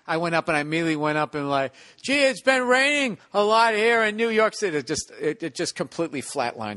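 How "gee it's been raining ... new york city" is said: high, loud, cheesy stage voice; hammed-up corny opener. Green comic opening his act after chaos, corny high delivery. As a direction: chirp it out like a hack comic.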